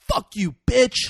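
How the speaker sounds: slow, loud, high mock yell; angry parting insult. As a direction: hurl it over your shoulder, drawn out. Retells parting insult, storming off.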